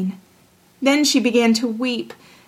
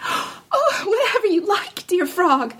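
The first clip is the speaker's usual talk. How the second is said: high, breathless-with-hope princess; gasp, then promise eagerly in a high voice; pleading princess, pitched high; 8 semitones higher, slower than usual. A narrator's reading.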